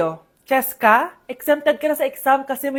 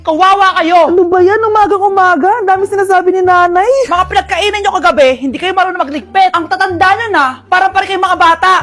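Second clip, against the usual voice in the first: loud nagging mom scolding, fast and loud; nagging mother; 4 semitones higher, 4 dB louder than usual. Acted. Mother scolds her grown children for sleeping late, loud nagging. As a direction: rail at lazy kids like a fed-up mom.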